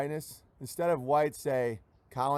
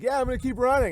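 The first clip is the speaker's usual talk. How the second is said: higher, louder determined mock runner; stubborn runner; 8 semitones higher, 5 dB louder, faster than usual. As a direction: insist you'll keep going, hammed up. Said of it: "It's like".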